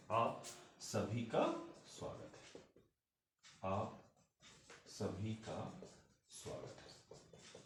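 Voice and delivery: "आप सभी का स्वागत है" slow, fading to a whisper on स्वागत है; soft slow model. Shown as a demonstration. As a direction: softly spell out "स्वागत है" for the learner. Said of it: "मैंने ये वर्ड्स दो तरीके बताए". ("आप सभी का स्वागत है" धीमे और धीरे, स्वागत है फुसफुसाकर)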